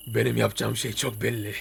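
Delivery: low, soft sneaky voice; hushed. Real, not acted. Sneaking past the uncle to the upper floor, hushed. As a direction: keep it down so uncle won't hear.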